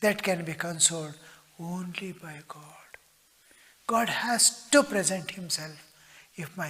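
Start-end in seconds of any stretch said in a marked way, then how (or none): 1.6-2.9 slow whisper, much softer. Real, not acted. Preacher whispering that only God consoles.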